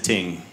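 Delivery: "Ting" short, t for th; learner's ting. Retold or imitated. To a Jamaican man, learner's "Ting". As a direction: say "Ting" plainly, like learning the word. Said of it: "I didn't say it the way he would".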